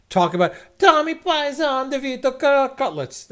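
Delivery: a hammed-up Italian accent, high and loud; lousy Italian accent. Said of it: "lousy Italian accent".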